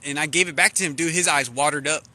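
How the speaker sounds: normal talking voice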